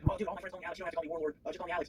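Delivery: high-pitched mock chummy voice; imitating an overfriendly drunk. Interviewee mimicking an over-familiar new acquaintance, high chummy voice. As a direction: play him pushily friendly, pitched high. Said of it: "And he was saying".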